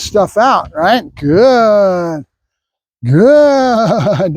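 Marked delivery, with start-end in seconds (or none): 1.1-2.2 long, stretched-out praise word; duration marker. Real, not acted. Trainer telling dog to keep waiting, drawn-out marker. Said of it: "accenting the good duration marker". 3.0-4.3 long, stretched-out praise word; duration marker. Real, not acted. Trainer telling dog to keep waiting, drawn-out marker. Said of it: "accenting the good duration marker".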